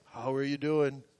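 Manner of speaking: very low, soft, fully worded greeting; formal greeting. Retold or imitated. Showing how the friend did not greet him, mock formal greeting. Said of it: "He didn't come up and say".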